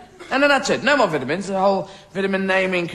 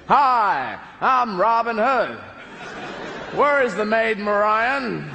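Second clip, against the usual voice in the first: put-on American accent; slower than usual.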